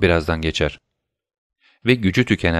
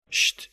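soft, unvoiced "shh"; hushing shush; 6 dB quieter than usual. A narrator's reading. Warning that someone is coming, soft shush. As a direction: hush him quickly and softly.

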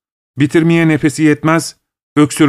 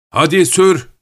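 a higher, mild trembling yell; trembling shout; 5 semitones higher than usual. A narrator's reading. Hunchback yells at the cab driver to hurry, mild shaky shout. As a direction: urge the driver on, a light shaky call. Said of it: "diye titrek bir sesle bağırır".

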